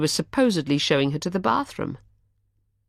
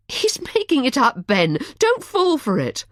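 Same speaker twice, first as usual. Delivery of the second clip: high, louder pleading protest; anguished outrage; 10 semitones higher, 4 dB louder than usual. A narrator's reading. Charlotte urging Ben not to believe the lie, outraged. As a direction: refuse to let him believe it. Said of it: "sounded anguished and outraged on his behalf".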